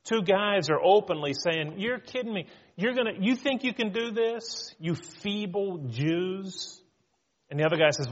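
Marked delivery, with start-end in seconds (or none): 1.8-6.8 higher-pitched mocking disbelief, slowing at the end; ridiculing. Two guys openly ridiculing the builders, mocking. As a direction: scoff incredulously, drawing out the final insult. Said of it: "They're ridiculing them".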